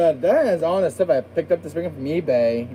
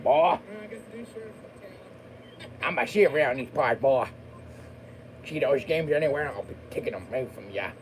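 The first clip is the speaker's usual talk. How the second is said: a hint of a slow sheriff drawl, softer; steady sheriff drawl; 7 dB quieter than usual. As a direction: play a mild small-town sheriff. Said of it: "my monster accent".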